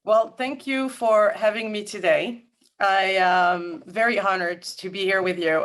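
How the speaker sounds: usual speaking voice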